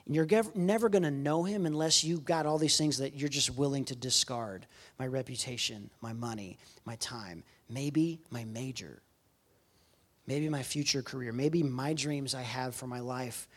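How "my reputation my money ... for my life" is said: low, soft reflective listing; quiet, lowered, sober delivery; solemn, hushed. Real, not acted. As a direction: drop right down, weighing each item. Solemn list of things to give up.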